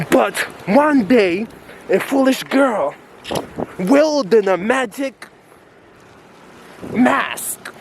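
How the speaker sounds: slow, dramatic movie-trailer voice; epic trailer narration. Acted. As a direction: narrate like an epic villain intro. Epic villain intro narration.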